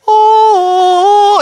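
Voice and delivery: high, loud sung swell of a movie soundtrack; sung soundtrack ooh. Pretend. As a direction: croon a heavenly soundtrack note. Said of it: "I don't know what the soundtrack was".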